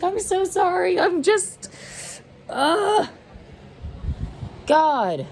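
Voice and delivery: high, tearful strained apology; voice breaking. Real, not acted. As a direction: voice cracking into a sob. Mocking apology, then breaks down.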